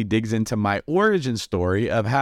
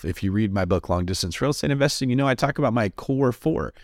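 ordinary delivery

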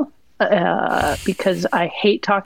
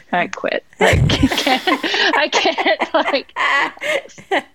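laughing, mock despair; 7 semitones higher, much slower than usual. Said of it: "I'm like".

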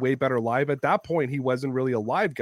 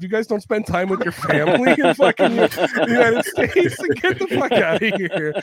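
loud, raised-pitch mock outrage; retold indignant outburst; 3 semitones higher, 7 dB louder than usual. Telling off the American boss over family time, mock-indignant. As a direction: replay an exasperated telling-off, loudly. Acting out his angry comeback to Nick.

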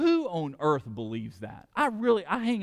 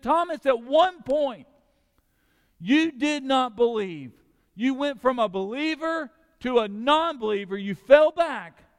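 slow, louder, weighty words of correction; Jesus rebuking Thomas; 11 semitones higher, 7 dB louder than usual. Acted. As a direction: lean on each word like a gentle rebuke. Jesus calls Thomas back.

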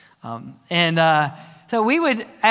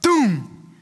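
a mock cannon boom; a big firework blast for the Spirit. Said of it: "hear the Holy Spirit go".

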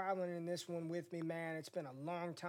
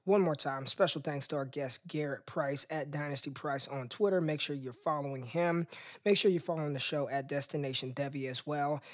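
louder upbeat host sign-off; podcast outro read; 3 semitones lower, 5 dB louder than usual. Acted. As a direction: wrap up the show like a radio host. Host reads the show outro.

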